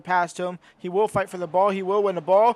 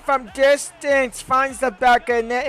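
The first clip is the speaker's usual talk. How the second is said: slow, higher, louder long-range goal call; 4 semitones higher, 6 dB louder, slower than usual. Real, not acted. Commentator calls the opponent's long-range goal, drawn-out excited call. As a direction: stretch it out as the long shot goes in.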